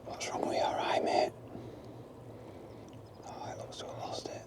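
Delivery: hushed whisper so owl stays; breathy whisper, barely voiced. Real, not acted. Quiet near owl.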